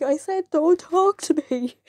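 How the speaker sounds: high retold "I said" rebuff; insist, fed up with being asked; mock exasperation. Pupil near tears to nosy classmates, mock exasperated. Snapping at classmates who keep asking.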